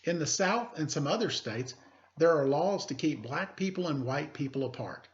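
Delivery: teacher reading a picture book aloud; story reading. Acted. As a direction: clear read-aloud storytelling voice.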